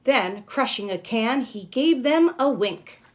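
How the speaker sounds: a lightly lively read-aloud; storybook reading. Acted. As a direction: narrate the ending with a touch of flair. Storybook narration resumes.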